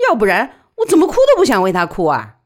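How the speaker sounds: fast, loud, high outburst; bitter retort. A narrator's reading. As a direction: throw it back angrily. Widow angrily justifies not crying.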